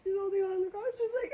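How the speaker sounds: normal talking voice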